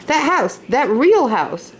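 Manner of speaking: lightly voiced Laura, pointing and slowing; a little girl pointing it out, drawn out; insistent child. Laura pointing out the house to Pa, slow and insistent. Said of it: "Laura po-, pointed".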